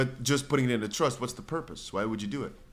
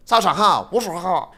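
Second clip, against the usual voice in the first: higher, louder presenter voice in Chinese; hammed-up YouTube host greeting; 6 semitones higher, 8 dB louder than usual. Shown as a demonstration. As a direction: put on the bright channel host voice. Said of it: "That's my YouTube voice".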